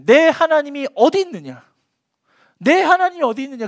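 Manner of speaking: loud mocking question; taunting cry. Mockers shouting the question at the psalmist. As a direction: fling the taunt at him. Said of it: "이 질문 때문에 그랬어요".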